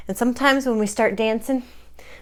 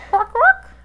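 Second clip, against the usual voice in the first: much higher, loud squawk; bird squawk; 13 semitones higher, 7 dB louder than usual. Pretend. Host squawks like a hidden bird. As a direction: squawk like a parrot.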